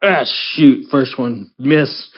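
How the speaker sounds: ordinary talk, nothing marked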